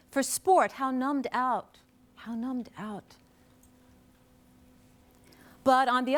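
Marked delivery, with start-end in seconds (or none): 2.1-3.2 much softer, reflective echo; quiet, sobered afterthought; hushed repeat. Real, not acted. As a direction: let it drop away almost to nothing. Same phrase repeated much softer.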